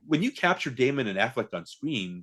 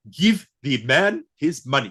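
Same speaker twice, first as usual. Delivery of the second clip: slow, louder mock Russian accent; lightly mocking a fake Russian accent, drawn out; Malkovich's bad Russian accent; 5 semitones higher, 7 dB louder, slower than usual. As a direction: ham up a poor Russian accent. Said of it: "I don't buy that you're Russian".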